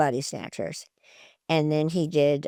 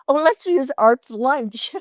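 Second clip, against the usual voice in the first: playful suggestion; 6 semitones higher, 5 dB louder, slower than usual. Retold or imitated. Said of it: "I said".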